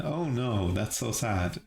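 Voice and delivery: plain reading voice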